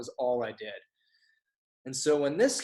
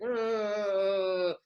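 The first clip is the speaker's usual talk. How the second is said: long high drone, slowly sliding down; writing-build-up noise; 6 semitones higher than usual. Retold or imitated. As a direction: hold a high, drawn-out "uhhh" like something building. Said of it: "this is how I write".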